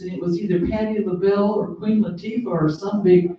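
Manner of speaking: usual speaking voice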